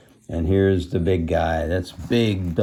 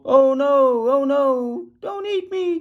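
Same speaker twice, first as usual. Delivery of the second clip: very high little-goat plea; hammed-up tiny pleading voice; squeaky cry. A narrator's reading. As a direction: squeal it as a frightened baby goat. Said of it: "cried the littlest goat".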